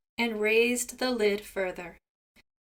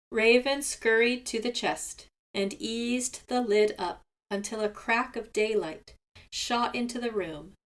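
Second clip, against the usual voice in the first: usual speaking voice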